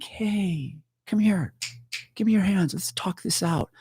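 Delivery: high, soft placating voice; hammed-up sweet negotiator; mock coaxing. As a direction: coax gently, as if calming someone dangerous. Mock coaxing of the disturbed woman.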